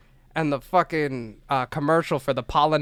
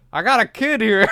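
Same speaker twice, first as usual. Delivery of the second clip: retold call-out, higher voice; 5 semitones higher, 4 dB louder than usual. Man announcing a found kid to the store, calling out.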